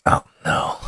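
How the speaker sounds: breathy whispered dread. Retold or imitated. Walking up to a man he knows, hushed whisper. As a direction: whisper it under your breath. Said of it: "I walk up and I'm like".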